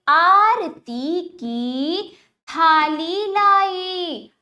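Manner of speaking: आरती की थाली लाई very slow and projected; emphatic word-by-word first reading of आरती की थाली लाई; slow demonstration reading. Shown as a demonstration. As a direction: stretch every long vowel in आरती की थाली लाई. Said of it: "अब वाक्यों का उच्चारण करते हैं".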